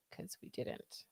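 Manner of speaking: voiceless whisper; whispered aside. Real, not acted. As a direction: murmur it as a confession. Whispered aside about shoes.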